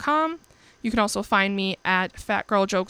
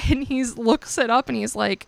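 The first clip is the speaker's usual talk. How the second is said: usual speaking voice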